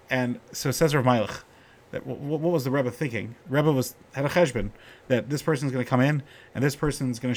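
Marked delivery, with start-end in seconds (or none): none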